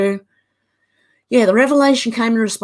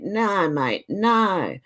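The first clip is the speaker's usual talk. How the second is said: ordinary talk, nothing marked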